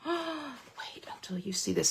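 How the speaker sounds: hushed gasp, then a whisper. Real, not acted. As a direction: breathe it like a secret. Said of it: "teasing whisper to viewers".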